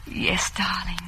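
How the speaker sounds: hushed, voiceless intimate whisper. Acted. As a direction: share a secret in the dark.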